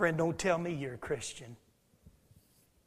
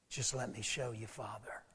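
soft plea; 5 semitones lower, 10 dB quieter than usual. Acted. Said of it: "he's saying".

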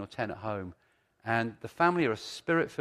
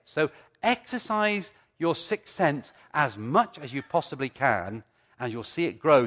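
preaching; 4 semitones higher, 6 dB louder than usual. Acted. Preaching at full force.

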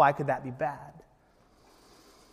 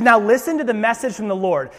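loud and higher, commanding; stern command; 7 semitones higher, 9 dB louder than usual. Acted. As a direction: order him to listen. Samuel commanding.

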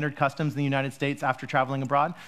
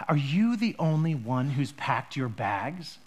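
slow, low, deliberate official question; stern customs officer; 4 semitones lower, much slower than usual. Retold or imitated. Customs officer grilling a traveler, slow and stern. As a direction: drag out the question like a suspicious officer. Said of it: "they say".

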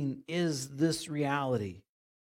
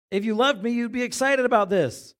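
higher, louder, fervent plea; passionate preaching; 9 semitones higher, 10 dB louder than usual. Retold or imitated. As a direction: drive the line home with fire. Preacher repeats Jesus passionately.

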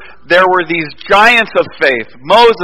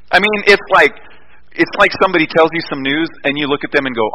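ordinary delivery

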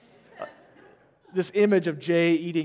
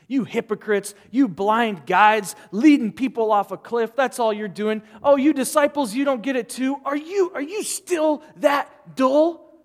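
exasperated rebuke; 5 semitones higher, 6 dB louder than usual. Retold or imitated. Jesus rebuking hypocrites and disciples, exasperated loud shout. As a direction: scold them, fed up. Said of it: "He's had it".

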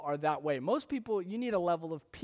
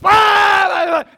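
imitating a toddler's piercing high tantrum shriek, much louder; toddler tantrum scream; 13 semitones higher, 19 dB louder, much slower than usual. Dad imitating his son Matt's tantrum, a high piercing wail. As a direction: howl it like a two-year-old mid-meltdown.

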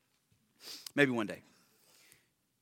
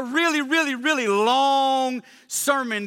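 "really, really, really long" raised and loud, with "long" stretched out; drawn-out emphasis; 4 semitones higher, 4 dB louder, slower than usual. Real, not acted. Preacher exaggerating Peter's long sermon, "long" loudly drawn out. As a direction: hammer each "really", then hold "long".

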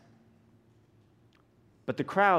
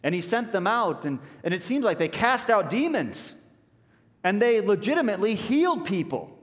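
rising higher and louder; building excitement; 7 semitones higher, 4 dB louder than usual. Real, not acted. Preacher recounting the disciples' miracles, building. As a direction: build momentum village to village.

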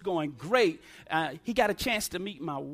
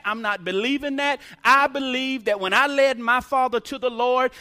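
loud, insistent sermon declaration; preaching at full force; 3 semitones higher, 7 dB louder than usual. Acted. Preacher declaring his father is in heaven, loud preaching. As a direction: build to a triumphant claim.

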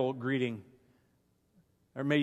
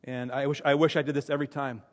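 normal talking voice

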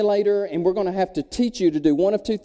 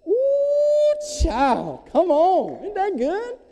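delighted ooh; 13 semitones higher, much slower than usual. Real, not acted. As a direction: savor it like sweet news. Preacher delights in the story.

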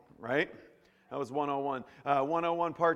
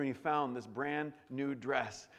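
ordinary delivery

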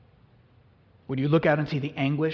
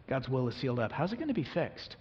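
ordinary talk, nothing marked

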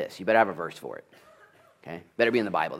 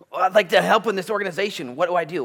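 higher, louder, eager mock volunteer; 9 semitones higher, 9 dB louder, faster than usual. Preacher mimicking a keen volunteer, high and eager. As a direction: play the keen volunteer asking how to help. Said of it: "mission volunteer asking".

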